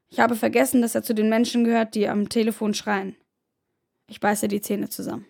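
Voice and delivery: ordinary delivery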